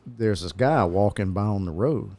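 ordinary delivery

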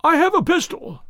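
high, frightened warning; scared threat, pitched high; reedy, quivering voice. A narrator's reading. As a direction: warn them off in a reedy quaver. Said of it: "a reedy, quivering voice".

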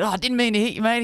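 high-pitched, laid-on-thick Aussie apology; hammed-up Australian accent. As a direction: ham up the Aussie accent to placate him. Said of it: "I, like, hammed up the accent".

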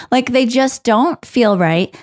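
usual speaking voice

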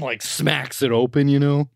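ordinary talk

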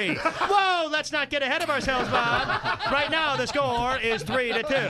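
sports announcer. Acted. Announcer cautioning his field reporter, mock earnest. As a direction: rein it in, then land the score slowly.

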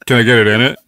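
low, far-off voice through a speaker; drive-thru speaker voice. Acted. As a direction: order through a crackly drive-thru box.

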